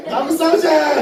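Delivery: high, loud laughing challenge; giant's challenge. Shown as a demonstration. Teacher models the line aimed at David, laughing challenge. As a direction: call it out to David, grinning. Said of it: "다윗한테 그래야지".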